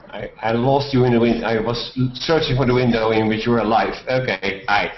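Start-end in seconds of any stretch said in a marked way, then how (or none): none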